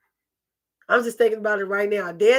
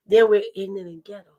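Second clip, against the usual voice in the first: usual speaking voice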